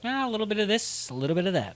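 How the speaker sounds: airy, noncommittal imitation, starting high; mock-evasive, keeping it casual; evasively vague. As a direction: brush it off with a quick, vague answer. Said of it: "Like, super vague".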